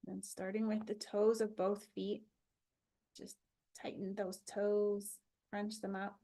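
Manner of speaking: calm body-scan guide with long pauses; even, unhurried relaxation voice; guided meditation. Acted.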